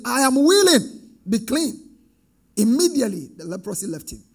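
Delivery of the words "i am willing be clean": commanding Jesus. Acted. Jesus voiced healing the leper.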